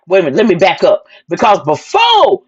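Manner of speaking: usual speaking voice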